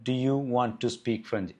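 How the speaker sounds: very slow, word by word; slow dictation. Shown as a demonstration. Teacher repeating the question slowly before giving the answer. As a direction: lay out each word slowly for learners. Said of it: "എന്താണ് അതിൻ്റെ answer".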